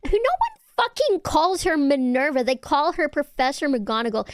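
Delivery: high, indignant scolding; mock outrage. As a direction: tell chat off in playful outrage. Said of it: "I fucking scream at them".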